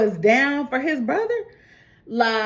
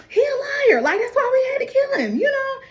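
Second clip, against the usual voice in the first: much higher, cracking he a liar; a hammed-up panicky cover story; frantic accusation. Angelo jumps in to blame the dead man, high and frantic.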